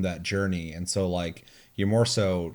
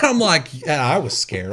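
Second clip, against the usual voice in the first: ordinary talk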